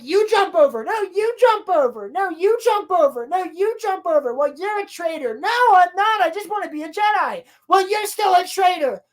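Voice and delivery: high, sing-song back-and-forth squabble; petulant retold argument; mock bickering. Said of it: "And they're arguing".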